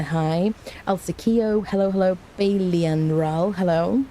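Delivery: ordinary talk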